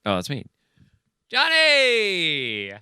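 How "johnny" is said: high, loud calling out a name; shouted name. Acted. Reading the part, calling out to Johnny in a loud high shout. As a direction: yell for Johnny across the graveyard. Reading a screenplay role aloud.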